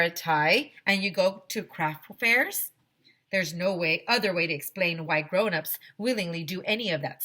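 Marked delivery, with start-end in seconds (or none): none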